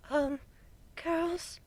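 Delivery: soft, high and timid; a shy little call for attention. A narrator's reading. Fluttershy gets the girls' attention, softly and timidly. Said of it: "her adorably soft voice".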